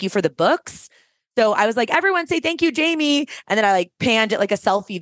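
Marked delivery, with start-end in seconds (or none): 1.9-3.3 high-pitched call to an audience; imitated emcee prompt, pitched well up; addressing a crowd. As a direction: re-enact cueing the whole room from the stage.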